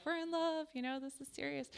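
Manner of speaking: high, soft, dreamy mock voice; mock lovestruck. Comedian mocks her own belief in the romance, mock lovestruck.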